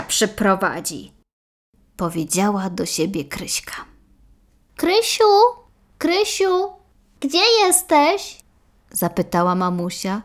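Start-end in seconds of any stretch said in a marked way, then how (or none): 4.8-8.4 put-on much higher, sing-song old mother's call; mother calling out for her daughter. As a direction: call for your daughter through the house, higher.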